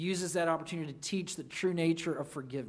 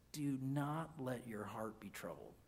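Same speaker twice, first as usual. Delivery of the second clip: tender comfort; 7 semitones lower, 13 dB quieter than usual. Acted. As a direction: comfort frightened friends in a near-hush. Jesus comforts disciples, repeated quote.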